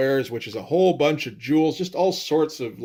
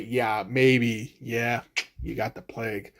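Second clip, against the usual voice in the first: hushed mock diagnosis; 3 semitones lower, 5 dB quieter, slower than usual. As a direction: trail off softer into bad news. Mock diagnosis trailing to the punchline.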